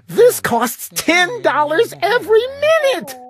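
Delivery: high, urgent protest; yelling on. A narrator's reading. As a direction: keep yelling, panicked about the bill. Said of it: "my sister yelled".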